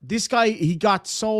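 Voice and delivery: ordinary talk, nothing marked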